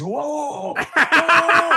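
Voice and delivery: high, loud alarmed whoa; startled whoa. Retold or imitated. Spielberg stopping him from walking over, a loud high whoa. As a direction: hold everyone back, whoa. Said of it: "Steven Spielberg and Ty is".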